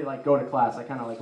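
ordinary talk

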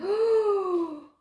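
high, loud cry of joy, slowing on the last word; thrilled exclamation. Real, not acted. Tarot reader cheering her viewer's blessed cards, overjoyed. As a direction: burst out in delight at the cards.

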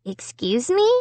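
mild, rising innocent question. A narrator's reading. Little brother answering accusing mom, innocent. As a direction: play innocent, voice lifting. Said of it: "gazed up at her innocently".

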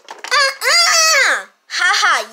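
mock them for falling in; taunting. Sister taunting sibling.

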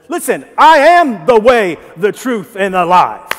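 Jesus firmly answering Thomas; strong, forceful. Acted. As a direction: proclaim it with full force. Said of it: "And he was strong about that".

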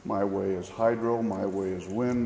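ordinary talk, nothing marked

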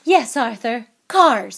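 loud, cutting confirmation; furious wife, words punched out; flashing anger. A narrator's reading. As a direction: fire back at the guilty husband. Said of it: "her eyes flashing".

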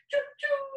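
very high imitation train whistle; light, high-pitched train noise; high choo-choo whistle. Shown as a demonstration. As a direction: toot a high sung choo-choo.

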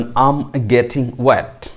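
extremely slow, words drawn out; very slow model. Shown as a demonstration. Teacher modelling the phrase first very slowly. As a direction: stretch the phrase out for learners. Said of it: "এটাকে আপনি বলবেন".

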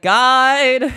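loud, high call for help; retold calling out. Stranded climbers summoning the guide, calling out.

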